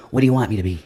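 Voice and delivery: ordinary talk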